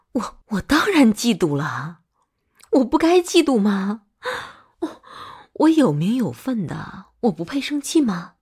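indignant, loud protest; jealous outburst. Acted. Jealous partner justifying their anger to a drunk lover, indignant. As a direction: raise your voice in hurt indignation.

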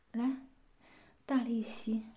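低声耳语的命令; whispered command. Acted. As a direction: hiss the order close up.

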